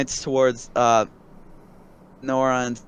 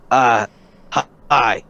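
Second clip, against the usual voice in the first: low blunt NPC voice; 5 semitones lower, 5 dB louder than usual. Acted. Axe-wielding NPC greeting.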